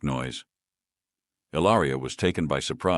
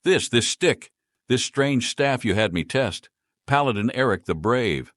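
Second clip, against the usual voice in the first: frantic; 7 semitones higher, 4 dB louder than usual. Acted. Frantic mage stammering.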